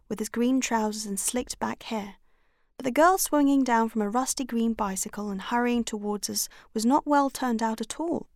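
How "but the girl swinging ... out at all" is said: ordinary delivery, nothing marked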